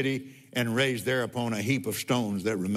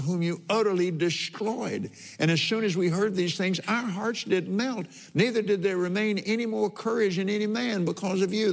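raised, fervent reading voice; scripture reading; 5 semitones higher than usual. Acted. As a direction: read the verses aloud, lifted and fervent. Reads Rahab's words from scripture.

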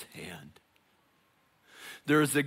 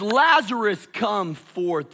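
Lazarus called out loud and higher; 5 semitones higher, 7 dB louder, slower than usual. Acted. Jesus calling Lazarus out of the tomb, louder. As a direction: summon him out. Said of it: "But he says".

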